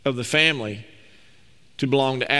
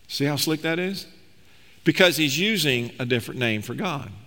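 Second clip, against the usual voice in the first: usual speaking voice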